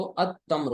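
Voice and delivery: अत तम्र with the l turned into a doubled t; sun-letter assimilation. Shown as a demonstration. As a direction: join अत तम्र on a held t, no l. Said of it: "अलिफ लाम जब दाखिल करेंगे". (अत तम्र, लाम के बगैर शमसी तलफ़ुज़)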